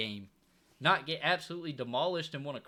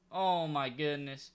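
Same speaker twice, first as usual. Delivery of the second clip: usual speaking voice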